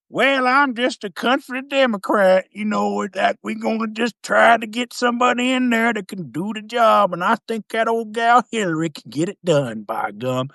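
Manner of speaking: country Democrat voice. Pretend. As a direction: ham up a hokey country politician.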